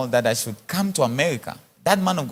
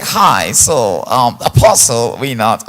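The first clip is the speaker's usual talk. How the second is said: mock public relations officer; 5 semitones lower, 8 dB louder, much slower than usual. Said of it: "You need to be my public relations officer".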